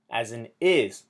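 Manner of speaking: ordinary delivery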